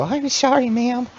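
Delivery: high, soft sheepish squeak; meek kobold apology. Acted. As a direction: apologise like a scolded pup. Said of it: "you hear him go".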